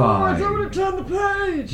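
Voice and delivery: mock terrified reader.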